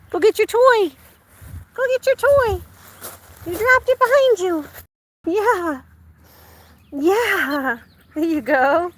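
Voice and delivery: high, loud sing-song talk to the dog. Real, not acted. Owner urging her dog to fetch, high baby talk.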